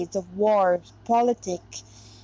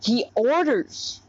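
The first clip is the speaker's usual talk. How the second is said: slow, weighty "orders"; He "orders" said slowly and firmly; stress on orders; slower than usual. Real, not acted. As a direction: lean hard on "orders" as a correction. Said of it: "no, actually, he doesn't predict".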